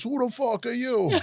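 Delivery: put-on high, brash old lady demanding; feisty little old lady. Little old lady challenging the newcomer, high and brash. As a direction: bark it like a tiny tough old woman.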